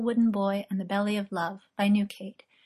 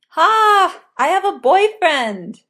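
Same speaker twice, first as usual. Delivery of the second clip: loud, high, triumphant outburst; gleeful shout; 10 semitones higher, 12 dB louder, slower than usual. A narrator's reading. Merlin celebrating his new boyfriend, shouting with glee. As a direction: crow it at full voice.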